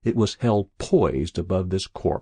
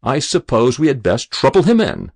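loud, higher, jocular delivery; humorous; 5 semitones higher, 8 dB louder than usual. A narrator's reading. Adjutant joking to Lean beside the grave, humorous. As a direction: make a hearty joke of it. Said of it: "he said humorously to Lean".

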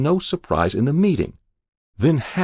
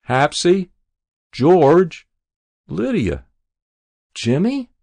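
put-on rising, louder calls for each child; calling out names; 8 semitones higher, 6 dB louder, slower than usual. Old mother on her deathbed calling for her children, rising calls. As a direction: call out for them one by one, higher.

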